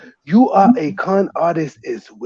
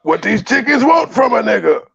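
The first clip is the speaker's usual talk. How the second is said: mock outraged shout; 7 semitones higher than usual. Host yelling at Joy for feeding her to the wolves, mock outrage. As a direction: shout at Joy like she betrayed you.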